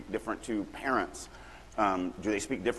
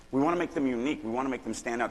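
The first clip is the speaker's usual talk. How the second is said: ordinary talk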